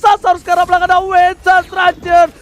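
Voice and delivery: excited shout. Real, not acted. Caster calling a clutch moment.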